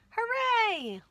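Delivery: high, loud hooray; cheering. Acted. Story characters cheer as cakes arrive, high and loud. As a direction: cheer it out for the children.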